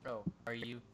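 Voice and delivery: dry sarcastic quip. Acted. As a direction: tease with a low sarcastic quip. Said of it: "She quips sarcastically".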